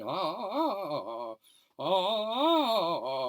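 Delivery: high, loud imitated screams; retold screaming. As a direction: cry out like a panicking patient. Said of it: "screaming ... on the top of her lungs".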